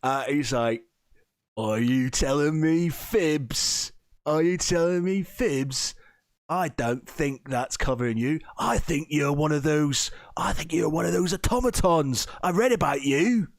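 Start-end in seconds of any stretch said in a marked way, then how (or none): 1.6-13.5 higher, swinging in-character voice; suspicious accuser. Acted. Game master as guard catching a liar, accusing.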